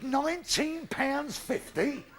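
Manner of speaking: high, slow, indignant protest; outraged disbelief. Retold or imitated. Outraged customer protests a nineteen-pound bill, slow and high. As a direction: stretch it out in disbelief.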